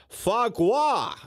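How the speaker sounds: Foie gras said fwa gwa, with a French r; laughing Foie gras in proper French; French pronunciation. Shown as a demonstration. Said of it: "which I love to say".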